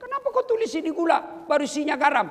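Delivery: high, loud indignant complaint; mock complaint. Husband complains to his wife about salt in the sugar jar, high and indignant. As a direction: protest it like a cheated husband. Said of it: "Saya pergi komplain".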